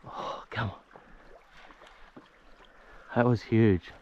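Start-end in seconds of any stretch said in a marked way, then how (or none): 0.1-2.2 breathy whispered groan, drawn out slowly; disappointed whisper. Real, not acted. Angler losing a big yellow lip, whispered dismay. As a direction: let it slip away under your breath.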